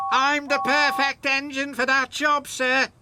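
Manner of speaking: high, loud, eager engine voice; James whistling. A narrator's reading. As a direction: whistle it out, keen to be chosen. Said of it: "James whistled".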